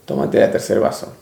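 low tempting inner voice of the ego; voice of his ego. Retold or imitated. Ego tempting him to drink the third cup, low and sly. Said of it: "mi ego me decía".

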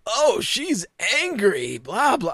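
mocking commentary. Host parodying the announcers' reaction, mocking. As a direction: stretch it slowly with swooping pitch.

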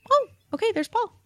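high, chirpy mock self; bright recognition. Spotting the waving man, she mimics herself brightly high. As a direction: pop up high like you spotted a friend. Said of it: "and I'm like".

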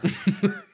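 high unvoiced laugh; burst of laughter. Real, not acted. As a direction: laugh out loud at the joke. Laughs at Cyber Monday.

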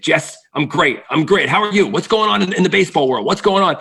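chirpy sick father insisting he's great; upbeat dad. Retold or imitated.

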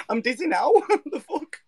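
ordinary talk, nothing marked

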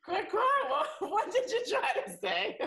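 high squealing laughter through the words; laughing. Real, not acted. Hearing a hilarious translation, laughing hard. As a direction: burst out laughing while asking.

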